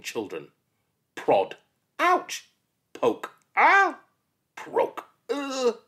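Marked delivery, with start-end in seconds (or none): 2.0-2.5 sudden squeal as the stick jabs; a quick high ouch; high yelp of pain. Acted. Child yelps at the teacher's prod, high squeal. 3.6-4.0 shrill cry as she's poked; loud high yelp. Acted. Poked child cries out loud and high. 5.3-5.8 a pained high ugh; high grunt of pain. Acted. Child jabbed once more grunts high.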